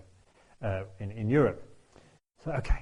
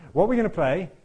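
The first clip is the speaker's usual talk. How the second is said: high, louder mock panic; panicked wondering; 7 semitones higher, 6 dB louder than usual. Invited player fretting about tomorrow's gig, loud high worried question. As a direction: repeat the worry louder, pitched up. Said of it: "and I'm thinking".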